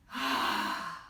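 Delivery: long breathy exhale of pleasure; demo sigh. Shown as a demonstration. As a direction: breathe out audibly as if smelling sauce. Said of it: "Take another big deep breath in".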